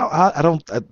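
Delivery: ordinary talk, nothing marked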